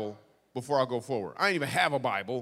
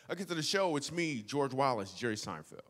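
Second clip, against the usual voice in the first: ordinary talk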